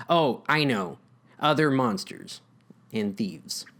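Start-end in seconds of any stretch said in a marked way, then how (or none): none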